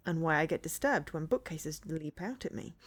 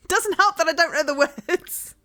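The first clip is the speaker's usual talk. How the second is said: loud, high laughing speech; real laugh breaking through the words; 8 semitones higher, 10 dB louder than usual. Real, not acted. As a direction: burst out laughing while talking.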